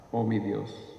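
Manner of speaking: usual speaking voice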